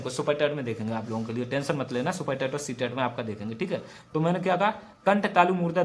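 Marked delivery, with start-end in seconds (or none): 0.0-3.8 quick quiet aside. Real, not acted.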